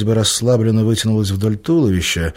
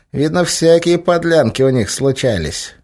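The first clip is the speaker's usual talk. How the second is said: high sneering cat; a cat grumbling knowingly; 8 semitones higher, 3 dB louder than usual. A narrator's reading. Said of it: "сказал кот".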